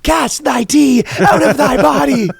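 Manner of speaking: high, slow hammed-up exorcist chant; mock exorcist. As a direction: intone it like a mock priest casting out a demon.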